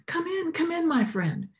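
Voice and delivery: high, eager call through the open door; calling out welcome. Acted. Cobbler calling the cold sweeper inside, high and eager. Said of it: "he called out to him".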